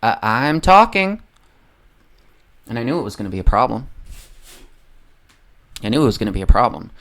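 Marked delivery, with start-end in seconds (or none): 0.0-1.2 higher, louder, slow mock retort; a hint of Kamala brushing off a heckler; imitating Kamala. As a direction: cut them off with a prim, drawn-out rebuke. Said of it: "and her response was".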